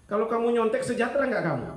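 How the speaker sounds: high, loud challenging question; pointed, loud challenge to students. Real, not acted. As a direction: call them out on cheating. Preacher challenges cheating students.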